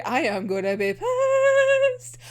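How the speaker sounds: high, slow, worked-up threat; gonna be pissed. Real, not acted. Fan warning the show about its backstory, high and slow. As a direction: drag it out, voice jumping up high. Vowing anger over the backstory.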